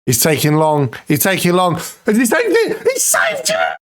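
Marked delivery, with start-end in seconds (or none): 1.8-3.8 much higher, disbelieving scream of joy; ecstatic shout. Real, not acted. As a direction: scream it as the save goes in. Keeper saves winning penalty.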